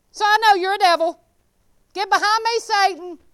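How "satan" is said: ordinary delivery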